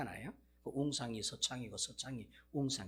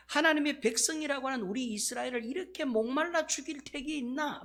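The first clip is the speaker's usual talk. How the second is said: angry crowd complaint; 11 semitones higher, 5 dB louder than usual. Acted. Israelites complaining against God.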